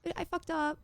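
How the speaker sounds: high, apologetic small voice; pleading phone voice. Shown as a demonstration. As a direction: shrink yourself and plead sorry. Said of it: "It's like".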